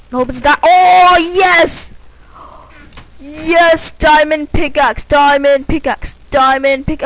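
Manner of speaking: high, loud, slow ecstatic shout. Real, not acted. Gamer finding a diamond pickaxe, shouting high and loud in triumph. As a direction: yell in triumph over the find.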